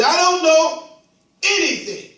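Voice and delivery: a lightly voiced high scarecrow. Preacher voicing the scarecrow.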